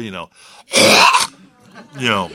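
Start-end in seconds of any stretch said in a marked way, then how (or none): 0.7-1.3 loud, throaty hawk; hawking up phlegm. Shown as a demonstration. Preacher demonstrating spitting to the congregation, hawking up phlegm. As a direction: noisily hawk up a big one. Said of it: "bring up a big hot tuah".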